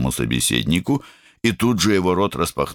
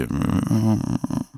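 жалобный стон; 9 semitones higher than usual. A narrator's reading. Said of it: "простонала что-то вроде".

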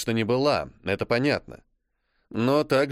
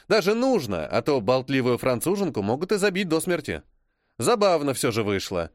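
ordinary delivery, nothing marked

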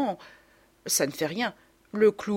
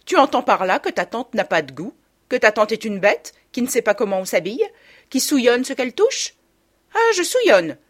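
loud, affronted aunt building to an outburst; offended and quarrelsome; indignant scolding; 5 semitones higher, 7 dB louder than usual. A narrator's reading. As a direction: hurl accusing questions, rising to a high cry. Aunt quarrels with her niece.

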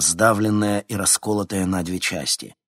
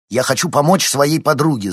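higher and louder angry retort; angry snap; 7 semitones higher, 8 dB louder than usual. A narrator's reading. Boy snapping angrily at the cynical reporter. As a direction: bite the words back at her. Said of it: "зло рявкнул Никита".

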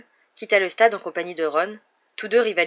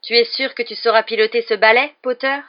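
louder, higher taunting question; drawling, icy; 5 semitones higher, 8 dB louder than usual. A narrator's reading. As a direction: taunt with a touch of cold disdain. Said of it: "une voix traînante et glaciale".

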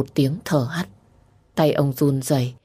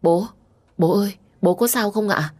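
higher, alarmed call to a father; acted worry, pitched up; panicked; 6 semitones higher than usual. A narrator's reading. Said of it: "Lâm hốt hoảng". (giọng hốt hoảng gọi bố)

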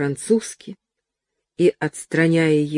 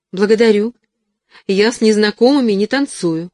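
restrained indignation; 5 semitones higher, 5 dB louder than usual. A narrator's reading. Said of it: "сдерживая негодование".

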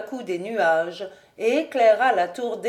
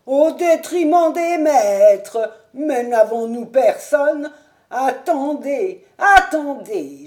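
high, slow aged fluster; stall, flustered and ashamed; flustered old servant; 5 semitones higher, 7 dB louder, slower than usual. A narrator's reading. Old servant to the guests, flustered and high.

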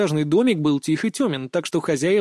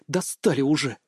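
usual speaking voice

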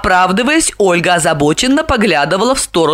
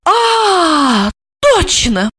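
much higher exclaimed realisation; sudden recollection; 11 semitones higher, much slower than usual. A narrator's reading. Olga suddenly remembering the stranger, high surprised exclamation. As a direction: burst out as it suddenly clicks. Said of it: "вспомнила она".

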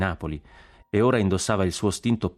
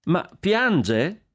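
high-pitched surprised question; astonished exclamation; 12 semitones higher, 4 dB louder than usual. Acted. Consuelo seeing Jack's tears, exclaiming in surprise. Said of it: "esclamò Consuelo".

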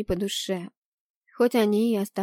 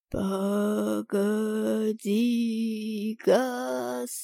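each syllable stretched out; drawn-out, slow-motion call; slower than usual. Acted. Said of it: "растягивая каждый слог, медленно проговорил".

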